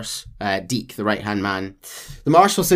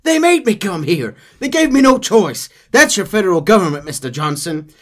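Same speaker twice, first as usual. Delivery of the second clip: grumbling marshal; 8 semitones higher, 7 dB louder than usual. Acted. Marshal complaining.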